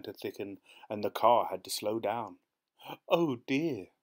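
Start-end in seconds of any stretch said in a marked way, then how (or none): 3.1-3.9 higher, louder cry of dismay; anxious wife's "oh, dear"; distressed cry. A narrator's reading. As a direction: cry out in worry. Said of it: "cried Missus Foster".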